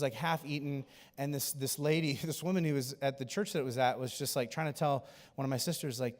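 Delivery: ordinary talk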